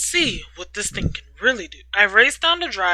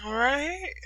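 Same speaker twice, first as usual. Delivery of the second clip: ordinary delivery, nothing marked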